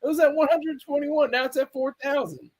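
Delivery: high-pitched mock outrage at the view count; disbelieving outburst. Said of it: "I'm like".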